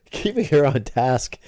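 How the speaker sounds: real laugh breaking into the words. Real, not acted. Parent on keeping a distracted kid on task, laughing.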